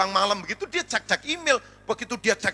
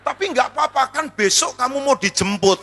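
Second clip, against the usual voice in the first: loud, raised reading of the email; punchline quote; 4 semitones higher, 8 dB louder than usual. Acted. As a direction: hit each word of the quoted message hard. Email reread as ominous punchline.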